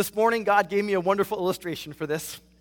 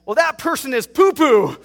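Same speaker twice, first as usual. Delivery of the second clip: put-on higher, louder little-boy indignation; imitating young son; 6 semitones higher, 10 dB louder than usual. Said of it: "my youngest said".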